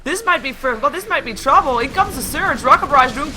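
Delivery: punchy esports caster; live play-by-play. Real, not acted. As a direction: call the action as it breaks out.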